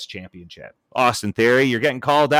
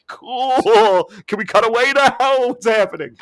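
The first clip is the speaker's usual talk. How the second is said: high, uneasy mock voice with a laugh; hammed-up high cringing plea; mock awkward viewer; 10 semitones higher, 4 dB louder, slower than usual. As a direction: squirm like an embarrassed viewer. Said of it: "And you're like".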